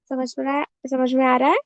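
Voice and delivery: ordinary talk, nothing marked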